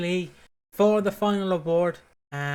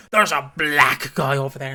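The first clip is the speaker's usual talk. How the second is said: contrasting delivery; 3 semitones lower, 7 dB louder than usual. Shown as a demonstration. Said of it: "and going".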